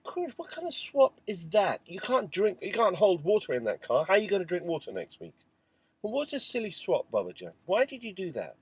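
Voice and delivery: high, incredulous telling-off of a son over a bad swap; father scolding his little son, high and exasperated. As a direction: scold him for the silly swap, disbelieving.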